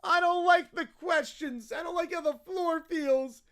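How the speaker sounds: put-on bratty child rattling off grievances; petulant, dragged-out little-kid complaining; whiny kid. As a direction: whine like a kid mid-tantrum. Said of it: "peak whiny kid territory".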